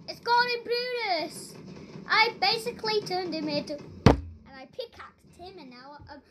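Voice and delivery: usual speaking voice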